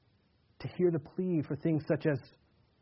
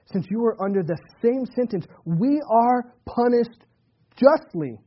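rebuking criminal; 8 semitones higher, 8 dB louder than usual. Acted. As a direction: scold him from the cross, pressing each word. Other criminal rebukes the first.

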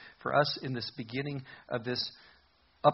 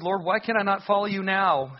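eager Peter; 7 semitones higher, 9 dB louder than usual. Acted. Preacher voicing Peter's eager question.

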